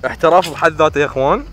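loud, proud bragging; excited boast. Real, not acted. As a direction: brag it out loud. Boasting after kills.

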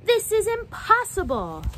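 high, loud frustrated outburst; loud exasperated cry; frustrated scream. Acted. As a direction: yell it like a kid losing a soccer game. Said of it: "she would scream".

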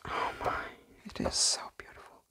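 a very soft, quick hushed whisper; awed whisper. Real, not acted. Moved by a beautiful book, awed whisper. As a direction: whisper it as if not to break the spell.

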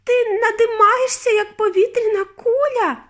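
very high, loud wail; horrified squeal. A narrator's reading. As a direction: shriek it in tearful panic. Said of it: "пропищала пані Борегард".

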